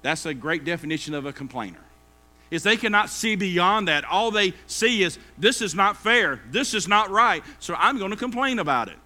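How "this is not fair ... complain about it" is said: stamp out each grievance; indignant complaint. Retold or imitated. Preacher voices a complainer: loud indignant whine.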